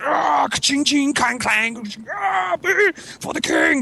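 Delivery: high strained war cries with clashing noises; mock medieval battle clamour; mimicked battle cries. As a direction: act out a sword fight, sound effects and all. Mimics men fighting in battle.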